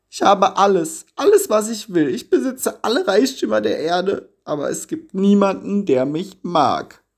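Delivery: high, tearful wail of the lonely rich man; lonely miser weeping to the bishop; weeping lament. A narrator's reading. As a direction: cry out your loneliness, high and pleading. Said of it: "weinte und weinte".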